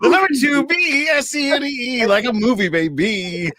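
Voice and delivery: ad plug voice. Pretend. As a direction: sell it like a cheesy ad. Higher plug pitch.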